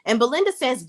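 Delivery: ordinary delivery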